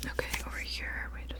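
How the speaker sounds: ordinary delivery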